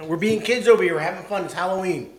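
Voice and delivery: loud and giddy after laughing; excited. Real, not acted. Laughing, playing like kids.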